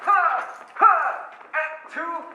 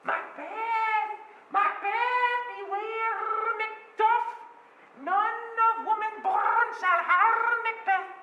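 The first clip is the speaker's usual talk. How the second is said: high, slow eerie witch voice; prophesy from over the cauldron; high eerie witch prophecy; 13 semitones higher, much slower than usual. Acted.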